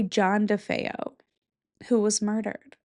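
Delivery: ordinary talk, nothing marked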